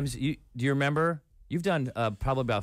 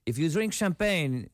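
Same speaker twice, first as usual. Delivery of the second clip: mock French accent, higher pitched; 5 semitones higher than usual. Comedian quoting what people in France told him, mock French accent. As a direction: put on a Frenchman's English. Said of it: "this is my French accent".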